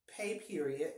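normal talking voice

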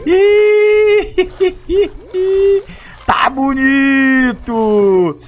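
high giggle, slow stretched words; squeaky excited laugh. Real, not acted. Hype moment laugh.

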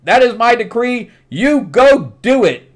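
high, loud voice of a commanding sun king; king's voice. Retold or imitated. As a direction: boom out his orders like a royal father. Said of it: "He's like".

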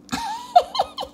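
very high giddy laugh; both friends rolling on the ground laughing; shared laugh. Acted. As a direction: laugh till tears come. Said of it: "giggling and laughing".

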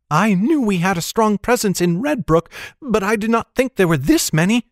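put-on high girl's voice, lightly winded; a touch of breathless alarm; out of breath. A narrator's reading. Girl on the run surprised by pursuers, mildly breathless. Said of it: "Annis's voice came quick between deep gasps for air".

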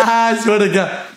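high, slow words through laughter; laughing hard. Real, not acted. Streamer cracking up at an insult, laughing words. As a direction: stretch it out while cracking up.

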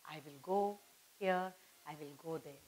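ordinary talk

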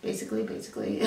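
deep, quick mock voice repeating herself; self-parody, low and quick. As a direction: drop way down and mimic yourself. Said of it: "how many times did I say basically".

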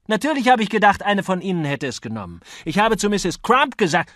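high, loud, indignant accusations; heated quarrel. A narrator's reading. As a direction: hurl accusations at your opponent. Two men quarrelling, accusing each other.

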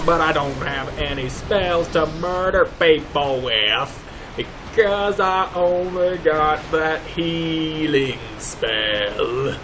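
usual speaking voice

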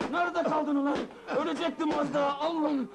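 high-pitched, frantic ranting; raging at someone while hitting him; furious tirade. Acted. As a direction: lash out hysterically, pitch held high. Boss rants while slapping hired man.

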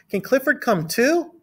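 high, questioning schoolgirl voice; Emily Elizabeth. A narrator's reading. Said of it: "she asked".

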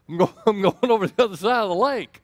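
half-laughing mock escape line. Jesus slipping away from the crowd, said laughing. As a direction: chuckle through it like a cheeky exit.

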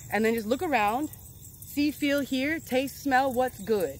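normal talking voice

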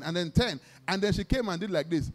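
usual speaking voice

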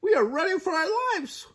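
very high chick, rushing as it builds; frantic Chicken Little. A narrator's reading. Chicken Little warns the goose the sky is falling, squeaking frantically. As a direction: climb into squeaky, hurried panic. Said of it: "rasped Chicken Little".

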